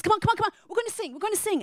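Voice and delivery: rally the room to sing; tone-deaf uncle calling everyone to sing; eager uncle. Retold or imitated.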